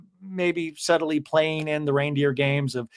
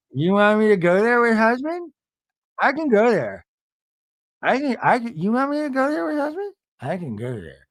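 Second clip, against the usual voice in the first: a hammed-up, higher defiant challenge; mock Meredith; 5 semitones higher than usual. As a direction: throw the threat back, higher and mocking. Meredith's fiery line, re-enacted.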